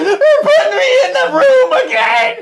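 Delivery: very high, drawn-out squeal; mock wailing protest. Co-host protesting the hiatus, high mock wail.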